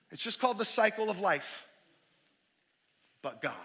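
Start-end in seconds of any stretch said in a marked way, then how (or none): 3.2-3.5 hushed. Real, not acted. Preacher drops voice, pausing.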